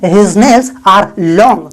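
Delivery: very slow, word by word; slow, clear dictation; slow model sentence. Shown as a demonstration. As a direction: spell it out slowly for learners. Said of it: "तब आप बोल सकते हैं".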